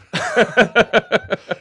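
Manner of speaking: high, rapid burst of giggles; laughter. Real, not acted. Cohost amused by own fifteen-years joke, giggling. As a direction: break up laughing.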